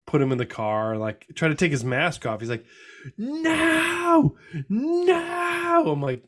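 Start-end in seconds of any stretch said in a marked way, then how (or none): 3.2-5.8 put-on much higher, wailing little kid refusal; dad imitating his son crying no in the car; crying toddler. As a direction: whine it like a sobbing two-year-old. Toddler refuses mask removal.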